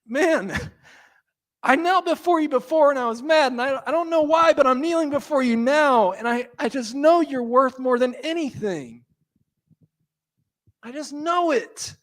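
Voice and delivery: lightly acted heartfelt devotion; Mary voiced. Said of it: "And she's just like".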